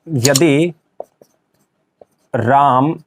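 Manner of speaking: words isolated by long pauses; word-by-word dictation. Shown as a demonstration. Teacher writing a Hindi sentence for students, halting dictation. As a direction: say each word as you write it.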